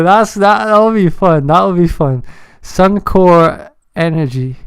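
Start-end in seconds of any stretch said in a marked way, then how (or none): none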